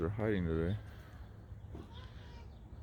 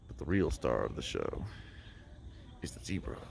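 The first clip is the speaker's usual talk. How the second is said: nature documentary; 8 semitones lower, 7 dB quieter than usual. Pretend. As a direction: narrate like a wildlife film. Mock documentary about zebra.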